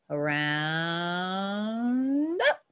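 long, slow "around", timed to the pencil; drawn-out tracing word. Real, not acted. Teacher tracing a circle for students, drawn-out word. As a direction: stretch "around" long and low, then "up". Drawing the circle as she speaks.

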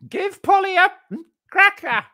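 high squawking parrot cursing. Pretend. Imitating parrot.

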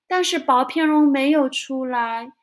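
ordinary delivery

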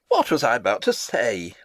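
ordinary talk, nothing marked

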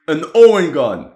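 loud and high, hitting Owen; thrilled to spot it; excited find. Real, not acted. Historian spotting the Owen gun, excited exclamation. Spots a rare Australian weapon.

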